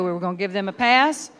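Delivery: usual speaking voice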